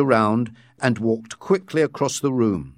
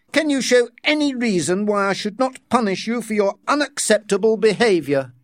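raised pitch, cold challenge; stern director voice; 9 semitones higher than usual. Acted. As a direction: demand an answer like a superior.

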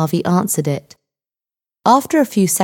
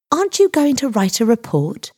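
higher-pitched disbelief; surprised question; 6 semitones higher than usual. A narrator's reading. Girl expecting more from the police, surprised. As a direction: ask it with mild astonishment. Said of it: "surprised that nothing more".

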